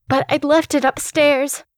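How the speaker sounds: ordinary delivery, nothing marked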